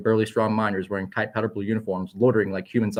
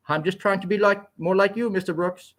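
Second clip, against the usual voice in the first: higher, bouncy, almost Swedish-sounding fellow; happy light voice; 10 semitones higher than usual. A narrator's reading. Doctor Carl greets Onyx, happy, light and higher. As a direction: chirp it like a cheerful young doctor. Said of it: "happy and light and almost Swedish-sounding voice".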